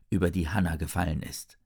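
usual speaking voice

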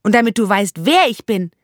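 high, loud, proud announcement; proud girl. Acted. Zora proudly tells the jailer who she is, high and loud. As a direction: proclaim your name like a triumph.

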